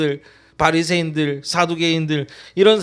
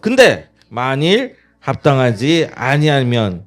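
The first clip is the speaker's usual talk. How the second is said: loud, measured congregational scripture reading; scripture read aloud together; 6 dB louder than usual. Real, not acted. Pastor leads the congregation in reading scripture, loud and slow. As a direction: read the verse slowly and loudly with the church. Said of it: "같이 읽어봅시다".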